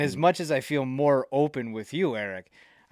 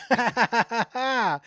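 high, loud "ah, ah" sobbing laugh; imitated laugh-cry; 12 semitones higher, 7 dB louder, slower than usual. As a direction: mimic crying through laughter. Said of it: "I'm doing the cry".

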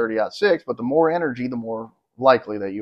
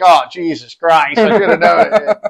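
groaning it out through a laugh, much higher and louder; laughing exasperation; 6 semitones higher, 9 dB louder than usual. Retold or imitated. Buyer realizes, second telling.

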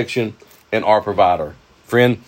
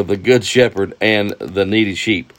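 ordinary talk, nothing marked

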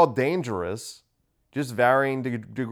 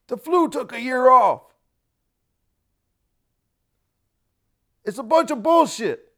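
put-on high, loud cranky old man; old guy voice; 11 semitones higher, 6 dB louder than usual. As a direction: holler it out like an eighty-nine-year-old. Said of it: "an all right old guy voice".

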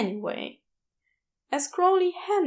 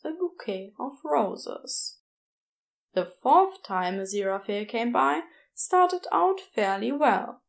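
usual speaking voice

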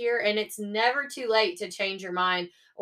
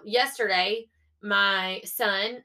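ordinary talk

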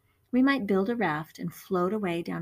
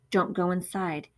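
ordinary delivery, nothing marked

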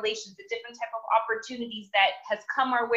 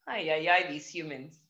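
exasperated sigh; 8 semitones lower, 4 dB quieter than usual. Retold or imitated. God sighs over humans.

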